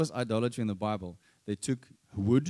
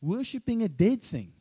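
raised, rebuking prophet's challenge; 6 semitones higher than usual. Retold or imitated. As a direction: call them out like a prophet. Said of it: "they use strong language".

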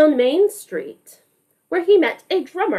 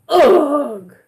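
disgusted ugh; 5 dB louder than usual. Acted. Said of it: "He says".

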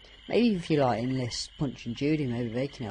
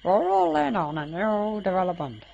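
high, raised puppet-like rant; odd character voice; 6 semitones higher, 5 dB louder than usual. Acted. Swamp character protesting the new development, in an odd high voice. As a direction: complain in a strange high creature voice. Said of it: "what that voice is".

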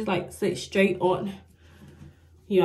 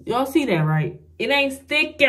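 frustrated; 6 semitones higher, 6 dB louder than usual. Real, not acted. Frustrated with failing lash.

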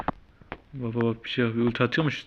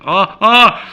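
sudden shocked cry, much louder and higher; startled shout; 9 semitones higher, 13 dB louder than usual. Real, not acted. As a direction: break off into a yelp of surprise. Sudden shock mid-game.

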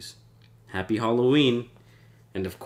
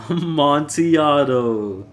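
loud, slow, higher moan of desire; drawn-out longing; 6 semitones higher, 7 dB louder, slower than usual. Acted. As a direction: savour the name, dragging it out. Fortunato craving the wine.

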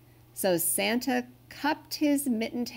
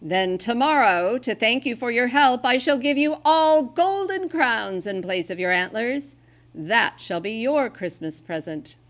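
louder, higher generous Santa; Santa promising; 4 semitones higher, 6 dB louder than usual. Acted. Santa promises golden crowns to the reindeer, loud generous voice. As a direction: announce the gift grandly.

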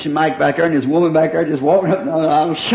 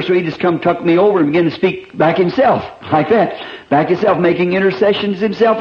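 fervent preaching; 3 semitones higher than usual. Acted. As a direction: preach it with mounting fervour. Preaching at full force.

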